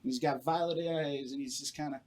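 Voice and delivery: usual speaking voice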